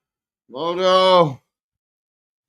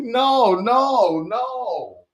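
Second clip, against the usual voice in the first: mock panic; 8 semitones higher, 3 dB louder, much slower than usual. Retold refusal to run intro.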